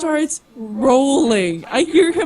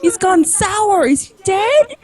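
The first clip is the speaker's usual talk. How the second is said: high mock-alarmed voice for the cats; puzzled cats; 7 semitones higher than usual. Cats sniff the drunk man's mouth, mock-puzzled high voice.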